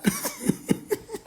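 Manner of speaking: sudden high-pitched cackle, soft; high squealing laugh. Real, not acted. Laughing at his phone-throwing plan, squeal laugh.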